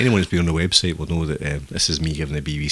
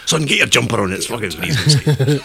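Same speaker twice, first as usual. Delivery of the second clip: loud, raised-pitch parental nagging; Glasgow father; 5 semitones higher, 6 dB louder than usual. Acted. Glasgow father ordering his son into a jumper, loud mock scolding. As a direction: call it out like a dad hollering at his boy. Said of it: "a father to say that to his son in Glasgow".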